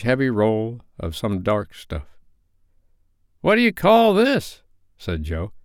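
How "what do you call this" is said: put-on high, loud creaky old fence; wheedling old Joe, raised and cackling; sly old pawnbroker welcoming them in; old Joe's voice. A narrator's reading.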